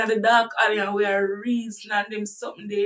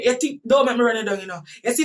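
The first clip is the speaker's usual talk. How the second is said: usual speaking voice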